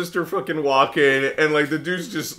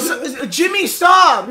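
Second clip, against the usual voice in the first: high, loud hammed-up telling-off; mock scolding shout; 10 semitones higher, 5 dB louder, much slower than usual. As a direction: imitate an exasperated mother shouting. Acting out a scolding mother.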